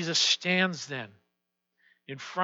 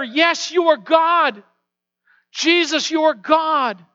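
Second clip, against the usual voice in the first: fervent declaration; 10 semitones higher, 11 dB louder than usual. Acted. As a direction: declare it like a shouted prayer. Voiced prayer declaration.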